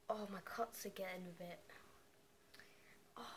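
pained whisper. Real, not acted. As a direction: whisper it, wincing. Lemon stings cuts.

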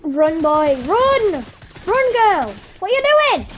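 urgent shouting. Real, not acted. Gamer yelling at players to run, urgent shouting. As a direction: yell it urgently, rising in pitch.

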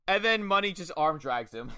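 ordinary talk, nothing marked

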